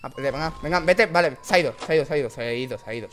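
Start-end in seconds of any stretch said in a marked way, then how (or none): none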